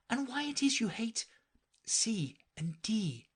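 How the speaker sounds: usual speaking voice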